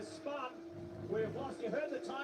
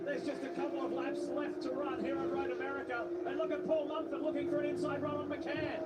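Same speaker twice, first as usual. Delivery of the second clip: motorsport commentator; 4 semitones higher than usual. Real, not acted. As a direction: lively race play-by-play. Live race play-by-play.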